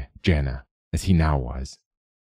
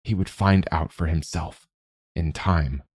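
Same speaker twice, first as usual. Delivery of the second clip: usual speaking voice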